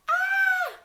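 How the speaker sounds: high loud shrieked Ah; children's shriek. Acted. Children at the picnic feel ants, shrieking Ah. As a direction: shriek as ants crawl up your arms. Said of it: "and shout".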